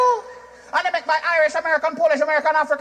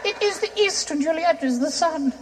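mock English Shakespeare; 4 dB quieter than usual. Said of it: "What I see in England".

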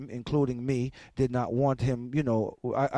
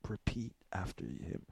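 low, hushed aside to the listeners; hushed thinking-aloud aside; inner thought, hushed; 8 semitones lower, 14 dB quieter than usual. Retold or imitated. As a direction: drop the voice like a private thought. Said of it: "what was going through my mind".

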